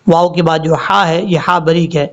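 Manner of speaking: drawn-out chanted tajweed reading; slow Quran recitation. Shown as a demonstration. Teacher reciting verse slowly for students. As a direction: recite slowly, stretching each sound.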